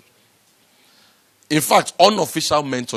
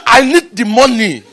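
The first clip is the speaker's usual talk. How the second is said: higher and louder, stressing I; loud insistent plea; 11 semitones higher, 9 dB louder than usual. Retold or imitated. Speaker retells insisting to the investor, loud and high. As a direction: insist hard on I. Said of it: "I said".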